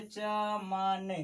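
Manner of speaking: चमन said slowly and softly, no force on the vowels; चमन unforced, slow and soft; soft short a. Shown as a demonstration. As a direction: say चमन gently, like you haven't eaten. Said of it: "उसको धीरे से बोलिएगा".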